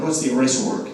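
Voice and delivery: ordinary talk, nothing marked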